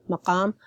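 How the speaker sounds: maqam with a throaty q, formal Arabic. Shown as a demonstration. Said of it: "I pronounced it, uh, formally".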